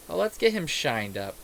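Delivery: ordinary delivery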